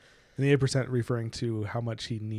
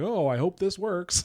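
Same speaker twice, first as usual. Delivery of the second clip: higher-pitched hopeful worry; mock uncertain hope; 7 semitones higher, 4 dB louder, slower than usual. Podcaster voices a hero unsure of his powers, mock uncertain hope. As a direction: imitate someone hoping it comes off. Imagined anxious casting of powers.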